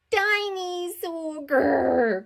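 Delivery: high, slow, drawn-out dinosaur shout; excited little boy roaring; toddler's cry. Acted. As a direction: squeal it and end in a loud growl. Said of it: "cried George".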